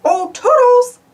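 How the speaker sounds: high, loud sing-song call. Acted. Mickey calls Toodles to come, loud high call.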